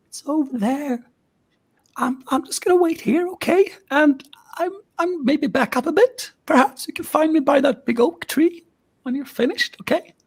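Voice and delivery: put-on high, nervous young woman; high and anxious, backing off; scared friend voice.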